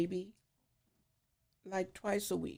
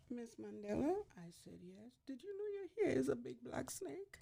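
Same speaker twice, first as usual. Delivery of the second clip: tearful whisper; 5 semitones higher, 11 dB quieter than usual. Retold or imitated. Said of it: "she started shaking and crying".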